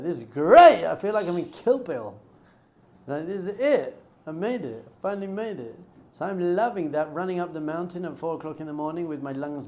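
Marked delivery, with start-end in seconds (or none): 0.0-5.8 excited. Retold or imitated. Retells loving tough martial arts training.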